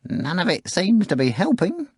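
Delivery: Korovyov, dry remark. A narrator's reading. Korovyov needles the chess-playing cat.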